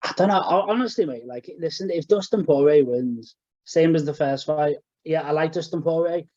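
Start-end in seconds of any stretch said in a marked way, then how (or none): none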